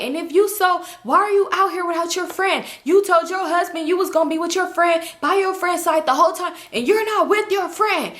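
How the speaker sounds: high-pitched mock scolding, no real shout; retold yelling. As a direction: scold as if acting out a row. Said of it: "He's yelling at her".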